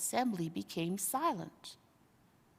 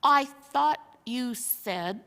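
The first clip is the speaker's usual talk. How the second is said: slow, louder hesitant start; drawn-out confused start; slow puzzled bear; 3 semitones higher, 5 dB louder, slower than usual. Acted. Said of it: "began the bear".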